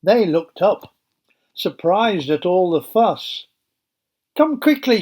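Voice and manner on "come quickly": high, breathless urgent plea; stammered. Acted. Rhoda running in to the praying group, high and urgent. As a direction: gasp it out in a hurry. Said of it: "she stammered".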